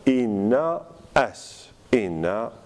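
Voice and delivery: "enaas" split slowly into ena and as; spelled-out hiatus. Shown as a demonstration. Teacher giving the written form, "enaas" in separated words. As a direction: pause between the two a's of "enaas". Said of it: "On va écrire tout simplement".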